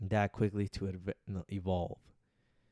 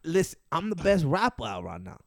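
higher, nasal rapper impression; six nine; 5 semitones higher than usual. Sticking with a rapper's voice, nasal and higher. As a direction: boast through the nose, New York style. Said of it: "sticking with the six nine voice".

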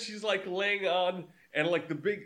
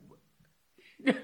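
baffled. Retold or imitated. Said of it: "I'm sitting there going like".

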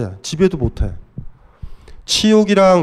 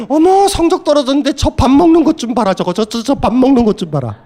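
high, indignant mock mother nagging; scandalized mother; 8 semitones higher than usual. Lecturer playing a mother appalled that her child eats. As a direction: cluck in outraged disbelief at the child.

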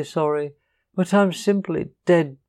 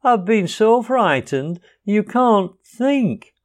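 frightened; 9 semitones higher, 4 dB louder, slower than usual. A narrator's reading. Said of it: "exhausted and still trembling".

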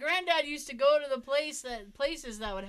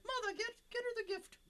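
a light imitation of an old grandfather, higher and softer; granddad voice; 11 semitones higher, 7 dB quieter, faster than usual. Granddad quoted talking to grandma.

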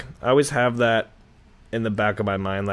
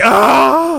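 loud high mock yelp; mock cry out; 9 dB louder than usual. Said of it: "He's like".

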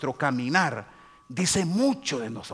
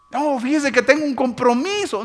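high, louder, sing-song excuse; mock excuse; 9 semitones higher, 7 dB louder than usual. Tenchis declining the vigil with a high excuse. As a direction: brush it off sweetly.

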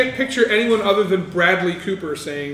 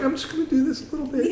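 soft, light and fast, half-laughing; soft and gentle; 7 semitones higher, 5 dB quieter, faster than usual. Retold or imitated. Mocking Drusilla's gentle bite to the podcast hosts, soft half-laughing coo. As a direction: coo it gently like nothing's happening. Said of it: "she literally just very softly is like".

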